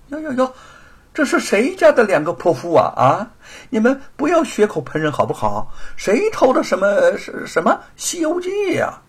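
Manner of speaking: high, loud, jeering denial; brazen mockery. Cornered thief denying it to the accusers, brazen mockery. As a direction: scoff as if wrongly accused.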